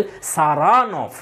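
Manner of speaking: Saranov slowly, with a long "RA"; stress on second syllable. Shown as a demonstration. Petkoff's way with the name, Saranov stressed on "RA". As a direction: stretch and stress the middle of Saranov. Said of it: "with the stress on the second syllable".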